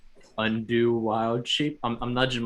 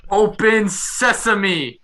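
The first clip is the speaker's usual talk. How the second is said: big, high, drawn-out shout; yelling out; 10 semitones higher, 8 dB louder, much slower than usual. Pretend. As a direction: holler it like a magic command. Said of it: "go and yell out".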